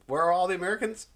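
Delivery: quick, high-pitched puzzled question; puzzled echo. Retold or imitated. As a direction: toss the question back, baffled. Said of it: "I was puzzled by their question".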